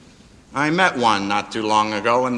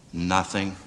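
calm gentle reply; 8 semitones lower, 8 dB quieter than usual. Retold or imitated. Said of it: "And he's answering".

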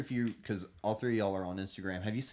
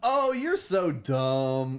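very slow, loud fake delivery; stilted sitcom actor; 5 semitones higher, 7 dB louder, much slower than usual. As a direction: drag out a stiff sitcom line. Parody of stilted sitcom line.

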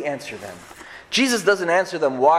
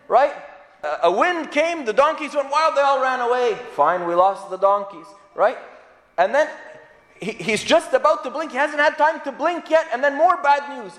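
ordinary delivery

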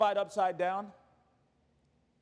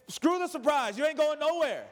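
higher, emphatic fed-up outburst; mock parental anger; 8 semitones higher than usual. Dad tempted to punish pouting kids, mock angry. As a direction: act out a parent cancelling the treat.

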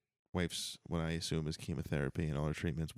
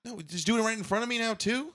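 high indignant outrage; 13 semitones higher than usual. Acted. Boss scolds employee, shrill outrage.

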